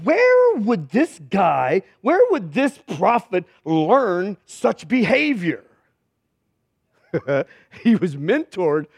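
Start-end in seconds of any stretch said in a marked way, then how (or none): 0.0-5.6 slow, drawn-out mock puzzlement; slow rhetorical question, heavily drawn out; mock wondering. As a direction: stretch each WHERE in exaggerated wonder. Mock-asks about the prophet's manners.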